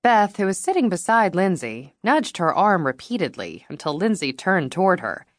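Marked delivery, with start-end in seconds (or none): none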